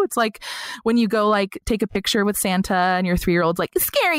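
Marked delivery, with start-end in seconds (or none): 3.8-4.2 higher, small mock-kid voice; scared toddler. Toddler fearing Santa, mock scared higher kid voice. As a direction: play the scared three-year-old, lightly.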